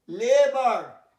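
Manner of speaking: লেবার slow and loud; slow careful pronunciation. Shown as a demonstration. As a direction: draw out লেবার clearly. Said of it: "teacher drills word". (ধীরে জোরে লেবার)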